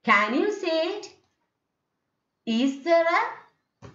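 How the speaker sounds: "Can you say it" swept up high; high questioning rise to the end; rising tone. Shown as a demonstration. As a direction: lift "say it" and "Is there a" as questions. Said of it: "will be in a rising tone".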